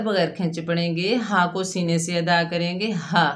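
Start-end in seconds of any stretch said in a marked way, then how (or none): none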